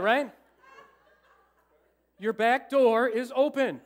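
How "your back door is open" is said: loud, slow, over-pronounced through the window; slow, loud and exaggerated with a laugh; slow exaggerated mouthing. Shown as a demonstration. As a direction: spell it out big and slow for a driver. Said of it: "I went like this".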